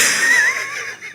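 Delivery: helpless squealing laugh; high shrieking laugh. Real, not acted. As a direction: let the laugh burst out high. Cracking up after a funny meme.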